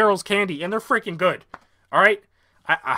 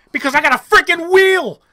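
loud, much higher boast; triumphant shout; 10 semitones higher, 7 dB louder than usual. Real, not acted. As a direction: crow about the wheel. Triumphant wheel announcement.

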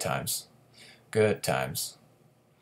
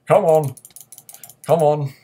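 higher, louder come on; impatient shouting; loud urging; 6 semitones higher, 10 dB louder than usual. Real, not acted. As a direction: push hard for the kill. Urging enemy to die.

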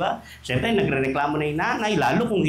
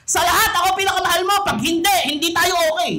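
mock jealous lover; 11 semitones higher, 4 dB louder than usual. Jealous demand voiced.

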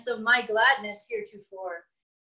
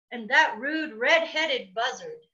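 ordinary delivery, nothing marked

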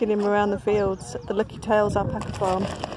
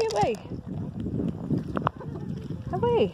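cooing to animals; 12 semitones higher, 5 dB quieter than usual. Real, not acted. Cooing to the alpacas.

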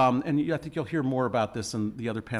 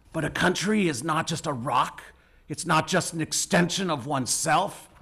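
Spencer Tracy; 3 semitones higher than usual. Acted. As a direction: deliver a solemn film speech, phrase by phrase. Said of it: "I'll do a Spencer Tracy accent".

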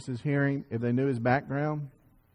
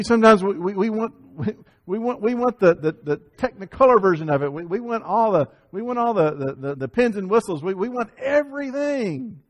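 high, loud preaching that climbs to a shout; fervent pulpit crescendo; rising preaching; 7 semitones higher, 5 dB louder than usual. Acted. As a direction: build the congregation up to a shout.

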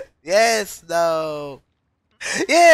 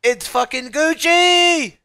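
slow, louder, higher drawn-out protest; exasperated outburst; 8 semitones higher, 5 dB louder, slower than usual. Real, not acted. Gamer fed up after falling again, slow exasperated yell. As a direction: drag it out in exasperation.

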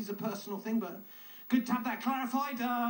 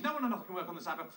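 rattled off quickly, no fuss; brisk and succinct; 6 semitones lower, much faster than usual. Shown as a demonstration. Comic rewriting God's rule, said fast and succinct. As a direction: fire it off fast as one tidy rule. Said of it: "it's clear what he means".

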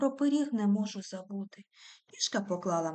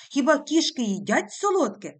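angry; 5 semitones higher, 9 dB louder than usual. A narrator's reading. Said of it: "каже вже сердито".

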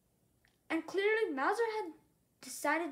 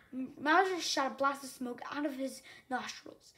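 ordinary delivery, nothing marked

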